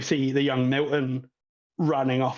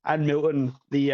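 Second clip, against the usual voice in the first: ordinary delivery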